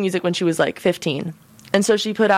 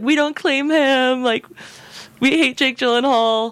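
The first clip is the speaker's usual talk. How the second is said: mock disowning; 6 semitones higher, slower than usual. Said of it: "We're like".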